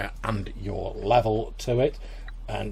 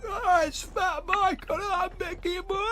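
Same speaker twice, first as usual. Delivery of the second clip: put-on much higher, slow slurred drunk jeer; really drunk guy; slower than usual. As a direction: jeer at him like a pub drunk. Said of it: "that really drunk looking guy being like".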